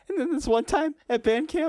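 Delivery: put-on high-pitched girl voice; movie girl impression. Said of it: "the one girl who's like".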